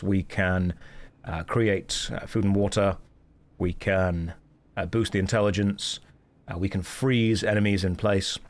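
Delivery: ordinary talk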